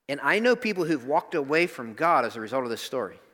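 normal talking voice

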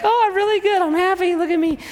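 high sing-song wife voice; wife's voice. Acted. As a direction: play the cheerful wife in falsetto. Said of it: "And she's like".

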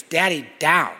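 imitated toddler demand, high and loud; insistent toddler. A toddler wanting down from dad's arms, imitated insistence. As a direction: mimic a little one insisting. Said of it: "It's very insistent".

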